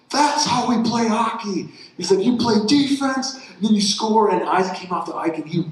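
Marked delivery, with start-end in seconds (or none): 0.1-4.3 high, slow cheering coach call; excited coach. Retold or imitated. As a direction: shout it like a thrilled coach praising the boy. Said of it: "jumped up and goes".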